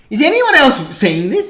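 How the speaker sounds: high, loud, slow incredulous cry; disbelief. Retold or imitated. Fan at the footy retelling a shocking scene, incredulous. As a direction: ask the room in stunned disbelief. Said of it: "And I was like".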